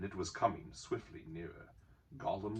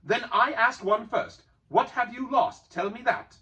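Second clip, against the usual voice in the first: Bilbo demanding; 15 dB louder than usual. A narrator's reading. Bilbo demanding Gollum tell what he lost. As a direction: press him for an answer.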